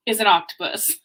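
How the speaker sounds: usual speaking voice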